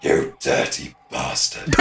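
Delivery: low, slow villain line; low slow growl. Acted. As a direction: drag it out low.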